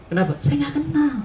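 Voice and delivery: usual speaking voice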